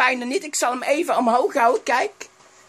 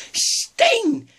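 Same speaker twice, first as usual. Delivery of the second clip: sudden recall; 10 semitones higher than usual. Acted. Suddenly remembers a name.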